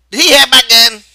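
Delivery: high, loud demanding question; Carlson's voice. A narrator's reading. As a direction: press him hard for an answer.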